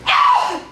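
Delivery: sudden acted yelp; startled pained "ah!"; sharp cry of pain. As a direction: cry out as if hurt in a fall. Child falls onto the bedpost.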